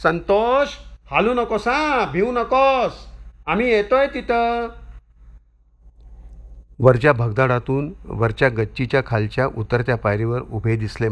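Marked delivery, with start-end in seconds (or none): 0.0-4.7 much higher, urgent call upward; father calling up to his stuck son, a high urgent shout. A narrator's reading. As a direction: shout up to the boy stuck on the wall. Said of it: "वडील ओरडून म्हणाले".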